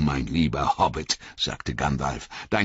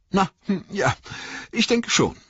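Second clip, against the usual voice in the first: high, hesitant stammer. A narrator's reading. Bilbo answers the wizard about the ring, stammering. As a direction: falter between words, pitched high. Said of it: "stammelte Bilbo".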